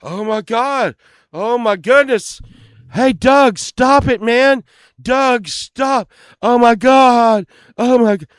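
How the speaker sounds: high-pitched hammed-up alarm; mock panic. Passenger begging his friend to stop, put-on panic. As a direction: pretend to be horrified and pleading. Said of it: "we would be like this".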